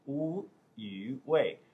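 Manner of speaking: "Wu Yu Wei" drawn out very slowly; slow name demonstration. Shown as a demonstration. Telling viewers his Chinese name, "Wu Yu Wei" said slowly and separately. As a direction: spell out "Wu Yu Wei" by sound, syllable by syllable. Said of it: "What is my Chinese name?".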